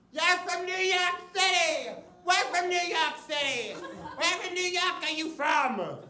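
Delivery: put-on high, squealing New York girl; unsubtle drunk girl. Drunk girl spotting a fellow New Yorker, high squeal. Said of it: "very subtly just goes".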